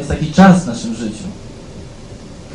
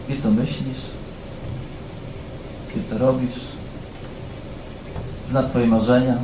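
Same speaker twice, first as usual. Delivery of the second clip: tender reassurance; 2 semitones lower, 8 dB quieter than usual. Acted. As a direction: comfort someone crying alone. Preacher comforts hurting listeners.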